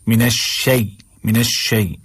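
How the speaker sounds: "शै" ending in a clear y; correct shay glide. Shown as a demonstration. Teacher giving the correction, "मिनश शै" with clear glide. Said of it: "शै नहीं पढ़ना है".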